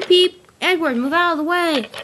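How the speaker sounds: loud, high urgent call; calling out a warning. Acted. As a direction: call out sharply to clear the track. Engine warns another to move.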